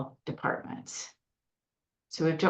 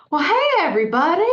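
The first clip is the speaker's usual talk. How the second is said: put-on toddler Southern accent; 11 semitones higher, 8 dB louder, faster than usual. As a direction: chirp it like a three-year-old from the South. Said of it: "she has a Southern accent".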